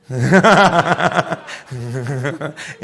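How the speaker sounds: big hearty laugh; loud laugh at the follower's eagerness. Real, not acted. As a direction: burst out laughing. Preacher laughs at own joke.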